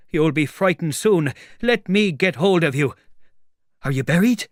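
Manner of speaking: high, loud, threatening tramp; blustering tramp. Acted. Tramp wanting to get hold of the voice, blustering. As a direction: bluster loudly at someone hidden.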